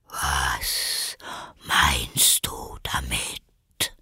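low, slow whispered demand; stern caterpillar. A narrator's reading. The caterpillar sternly questions Alice in a whisper. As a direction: press her in a low whisper. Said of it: "fragte die Raupe streng".

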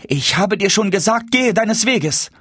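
high-pitched, wrathful dismissal; angry rebuff. A narrator's reading. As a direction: send him off angrily, stretching the final words. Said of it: "entgegnete Frau Hanne zürnend". (zürnend, hoch und abweisend)

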